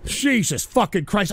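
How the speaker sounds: normal talking voice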